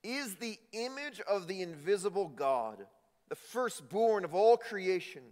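high, loud, building proclamation; preaching at full force. Acted. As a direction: proclaim the scripture with rising fervor. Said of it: "He says this".

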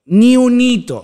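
loud emphatic repeat. Acted.

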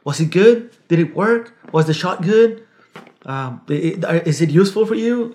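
lightly voiced helpful contact; imitating an eager friendly contact. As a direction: play him overly accommodating. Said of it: "Very accommodating".

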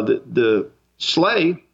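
ordinary delivery

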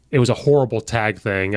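ordinary talk, nothing marked

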